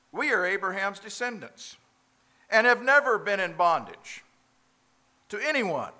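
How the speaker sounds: indignant crowd. Acted. Preacher voices the crowd's retort.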